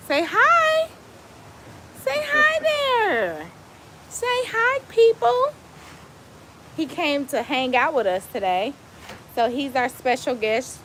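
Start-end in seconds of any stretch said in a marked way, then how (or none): 0.1-5.5 pet talk. Real, not acted. Owner to her dog on live, high baby-talk voice. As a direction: coax the puppy to say hi, squeaky and sweet. Coaxing the dog to greet viewers.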